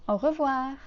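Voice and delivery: au revoir with a French R; teacher's au revoir. Shown as a demonstration. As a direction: model au revoir clearly. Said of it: "we say".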